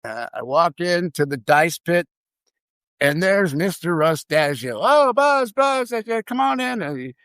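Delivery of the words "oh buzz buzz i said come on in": high-pitched, hearty greeting; effusive welcome. Retold or imitated. Casino boss waves the new hire in, effusive. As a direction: call the newcomer in, voice raised in pitch.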